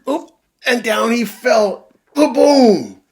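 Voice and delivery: shouted fall sound effect; loud crash. Acted. Storyteller shouting the crash of dad falling. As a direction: boom it out, big and high.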